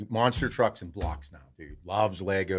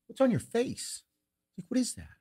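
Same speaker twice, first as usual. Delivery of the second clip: high alarmed question; surprised; 13 semitones higher than usual. Retold or imitated. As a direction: sound startled and high. Dad alarmed at face.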